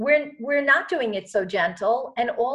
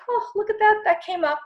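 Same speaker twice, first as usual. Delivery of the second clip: high-pitched, airy little note of discovery; casually notice it, lifting the pitch high; light mock wonder; 11 semitones higher, 3 dB louder than usual. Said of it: "just be like".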